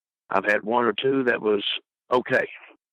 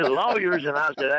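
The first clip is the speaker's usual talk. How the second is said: ordinary talk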